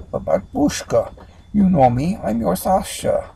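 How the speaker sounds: put-on high, loud Russian-accented plea. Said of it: "Her accent changes to Russian".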